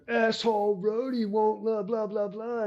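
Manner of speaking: high, slow sneering mock of a mad mountain biker; mocking angry rider. Rider on Tim's wheel griping at roadie, mocking sneer. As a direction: sneer it like the rider who got dropped. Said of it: "He's like".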